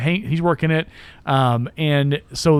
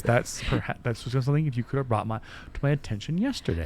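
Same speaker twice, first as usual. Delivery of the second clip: quietly; 3 semitones lower, 9 dB quieter than usual. Retold or imitated. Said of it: "so he says it quietly".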